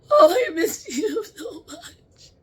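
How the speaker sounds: high, slow, tearful, with a laugh through the tears; weeping and laughing at once; crying. Real, not acted. Medium telling a loved spirit she misses them, crying. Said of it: "I'm sorry to cry".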